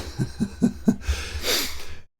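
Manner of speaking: soft, breathy chuckling; real laugh trailing on quietly; amused laugh. Real, not acted. Game master amused as the attack misses, laughing.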